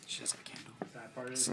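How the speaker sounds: breathy hushed repeat; whispered echo. Pretend. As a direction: whisper She has a candle in awe.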